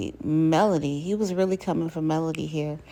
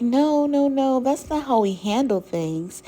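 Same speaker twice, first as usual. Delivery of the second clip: high, loud mock objection, easing off; pitched-up retelling of someone insisting no; retold protest; 8 semitones higher, 4 dB louder than usual. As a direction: jump in with an emphatic mock protest. Retold protest from cast member.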